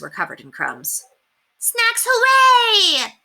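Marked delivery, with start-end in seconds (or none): 1.6-3.1 high, slow, drawn-out cheer; child showing off the cake, gleeful and high; excited child cheer. A narrator's reading. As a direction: announce it like a thrilled child. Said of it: "Cassiopeia announced".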